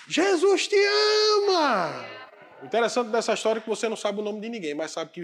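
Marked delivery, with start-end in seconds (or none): 0.1-2.0 very slow, high, loud drawn-out call; voice of God. Acted. God answering the despairing man, slow ringing proclamation. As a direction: ring it out like a voice from heaven.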